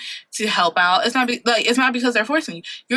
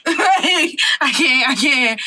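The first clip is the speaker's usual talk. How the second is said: higher, laughing mock desperation; laughing plea; 4 semitones higher, 4 dB louder, much slower than usual. Joking prayer to God over lost keys, laughing plea. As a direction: plead through a laugh.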